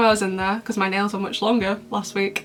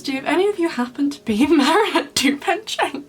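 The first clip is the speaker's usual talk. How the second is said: higher, playful hero voice with a laugh; voicing Cat Noir; 5 semitones higher than usual. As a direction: ask it like a lovestruck cat boy, grinning. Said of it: "Cat Noir says".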